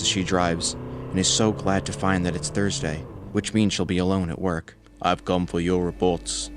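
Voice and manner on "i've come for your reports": mock Austrian accent, at usual pitch. A narrator's reading. Dan jokes with Sarah about collecting reports, Austrian accent. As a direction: put on an Austrian accent for a joke. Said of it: "Dan says in an Austrian accent".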